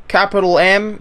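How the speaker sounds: ordinary talk, nothing marked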